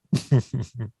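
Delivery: high chuckling laugh; short, high run of giggles; amused laugh. Real, not acted. Host laughs with guest over who brought up crying, high amused laugh.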